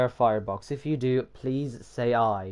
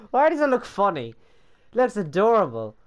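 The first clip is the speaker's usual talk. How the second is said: high-pitched, delighted voice; amused squeal; 7 semitones higher, 4 dB louder, faster than usual. Real, not acted. Delighted by the funny little model, high amused voice. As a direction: coo high at something silly and cute.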